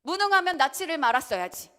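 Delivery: loud, high, bitter outburst at parents; angry child's retort. Retold or imitated. Child lashing out at parents, shouted. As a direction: hurl it at the parents like a sulking child. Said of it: "자식들이 이렇게 말을 해요".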